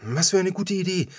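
bright lift on the whole line; delighted. Acted. Ava delighted at the rabbit idea, lifted voice. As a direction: sound thrilled by the idea.

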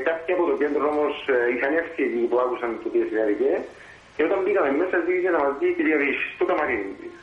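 ordinary delivery

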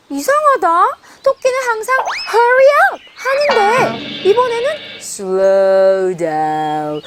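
ordinary talk, nothing marked

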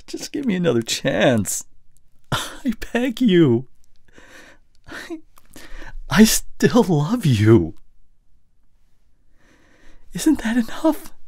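high, strained, drawn-out plea; tearful begging. Acted. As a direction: beg pitifully, pitch climbing high. Last plea for another chance.

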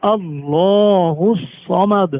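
slow, drawn-out chanted recitation; slow tajweed recitation of আল্লাহু সমাদ; Quran recitation. Shown as a demonstration. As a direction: recite it slowly with full tajweed. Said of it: "আমরা পড়বো সমাদ".

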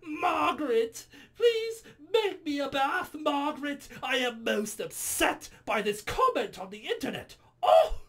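hammed-up pampered snob in distress; imagined commenter voice. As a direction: whine high and slow like a pompous snob. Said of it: "in the voice I imagine".

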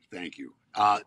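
normal talking voice

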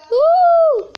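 very high, loud excited whoop. Real, not acted. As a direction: shout a happy whoop. Excited sign-off cry. (grito animado)

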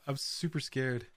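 usual speaking voice

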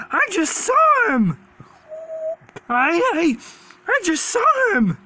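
high, drawn-out exclamations, worked up; frantic shark hunter. Acted. Hunter who lost sight of the dumb shark, frantic.

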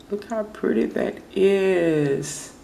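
high, drawn-out delight; gushing admiration. Real, not acted. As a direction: gush slowly, pitch lifted. Showing off her nails admiringly.

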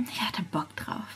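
whispered aside to camera. Real, not acted. Vlogger whispering a secret aside to viewers.

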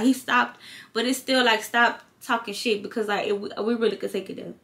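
ordinary talk, nothing marked

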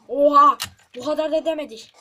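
loud startled outburst; shocked shout. Real, not acted. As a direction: blurt it out in shock. Shocked at sudden trap.

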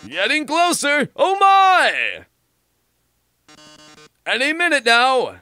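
high, excited theatrical taunting; gloating showman. Acted. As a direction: tease the doomed player. Villain host gloating.

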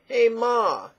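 calling out. Pretend. Calling out to mother.

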